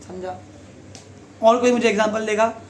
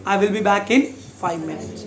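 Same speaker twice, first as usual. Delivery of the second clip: slow, spelled-out teaching line; slow example sentence; much slower than usual. Shown as a demonstration. Teacher slowly modelling an example sentence. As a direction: say it slowly so kids catch minutes. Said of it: "उसका मतलब minute".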